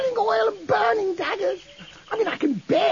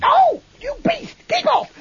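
loud protest; 8 semitones higher, 4 dB louder than usual. Acted. Bunter kicked, protesting loudly.

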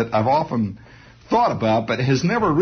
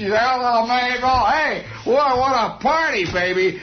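higher, boozy praise for his wife; hungover husband; 9 semitones higher than usual. Retold or imitated. Husband cleaning dishes praises Mabel, boozy and high. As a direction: play the happy drunk fussing over Mabel. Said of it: "It shows him".

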